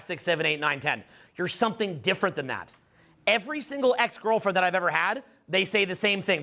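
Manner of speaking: usual speaking voice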